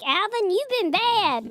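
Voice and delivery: scolding Dave. Retold or imitated. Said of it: "Be like".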